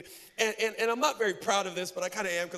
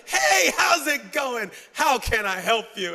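loud, drawn-out mock-cheery greeting; slow, happy clerk; 3 semitones higher, 9 dB louder, slower than usual. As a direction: imitate an overly happy, unhurried shop clerk. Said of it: "someone slow and happy. She's like".

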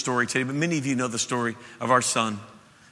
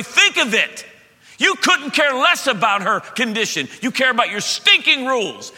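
much higher, louder impassioned rebuke; preaching at full force; 7 semitones higher, 5 dB louder than usual. Acted. Preacher voicing Jesus rebuking the ruler, shouting. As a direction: thunder it from the pulpit.

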